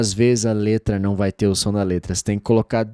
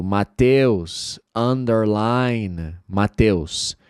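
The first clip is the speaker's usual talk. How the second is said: slow model word; much slower than usual. Shown as a demonstration. Teacher modeling English Underline slowly. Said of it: "por exemplo".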